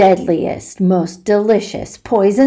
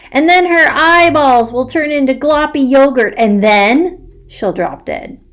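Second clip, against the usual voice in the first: gleeful; 7 semitones higher, 6 dB louder than usual. Acted. Gleeful gory description.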